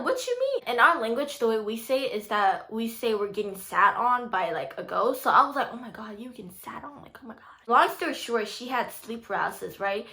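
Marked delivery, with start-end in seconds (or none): 0.0-0.7 very high-pitched puzzled question; acted puzzlement. Daughter hearing about sleep paralysis first time, acted puzzlement. 5.7-7.4 retold hushed, whispered disbelief; shocked whisper. Daughter learning mom got sat on by a ghost, shocked whisper.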